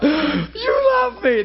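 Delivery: high, slow, tearful cry. Retold or imitated. Preacher showing how he cries alone with God. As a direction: weep the words out slowly. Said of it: "That's how I get when I'm alone".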